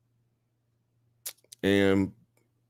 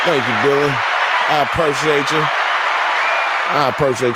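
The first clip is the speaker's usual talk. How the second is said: hammed-up celebrity gratitude, a bit louder; mock gracious star; 4 semitones higher, 3 dB louder, faster than usual. Thanking chat like a celebrity, hammed-up.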